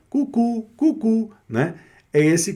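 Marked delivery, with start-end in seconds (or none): 0.1-1.3 high two-note cuckoo call; cuckoo-clock bird. Acted. Teacher imitates the bird popping out of the clock, cuckoo call. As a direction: chirp it like the little clock bird. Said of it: "Que vem o passarinho e fala".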